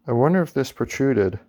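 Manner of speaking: usual speaking voice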